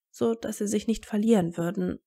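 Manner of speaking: plain reading voice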